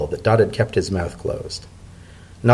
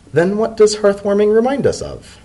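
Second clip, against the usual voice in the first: a hint of a cool, higher-pitched foreign dignitary; lightly voiced ambassador Macaulay; 11 semitones higher than usual. A narrator's reading. Ambassador questioning the secretary about the holiday, lightly voiced higher.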